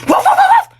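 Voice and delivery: high loud laughing dog barks; mock barking. Baby mocking the mother by barking like a dog. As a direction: bark like a puppy to tease her. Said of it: "Gusto mo tumahol ako?".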